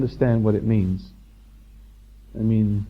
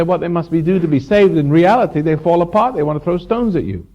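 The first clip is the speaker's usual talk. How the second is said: higher and louder, driving the words at the crowd; fiery preaching; 6 semitones higher, 5 dB louder than usual. Acted. Teacher rousing his audience against religious hypocrites, fiery preaching. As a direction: preach it with full conviction.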